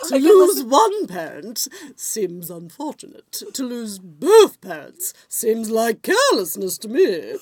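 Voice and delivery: Lady Bracknell. Retold or imitated. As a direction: declaim like a haughty Victorian aunt. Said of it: "just doing that voice".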